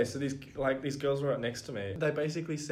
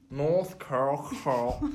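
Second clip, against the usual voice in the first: slow broad Aussie drawl on North Curl Curl, vowels stretched; broad Aussie accent; much slower than usual. Shown as a demonstration. Asked by her co-host to say it in an accent, slow broad Aussie drawl. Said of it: "Say it in an accent".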